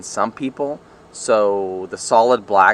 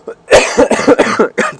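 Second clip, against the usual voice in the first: hacking cough fit; 13 semitones higher, 9 dB louder than usual. Real, not acted. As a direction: cough hard, chest rattling. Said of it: "Dang, I'm dying here".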